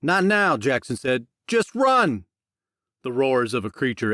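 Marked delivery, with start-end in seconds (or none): 0.0-2.2 urgent shout. Acted. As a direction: yell while fleeing. Fleeing creature, urgent cries.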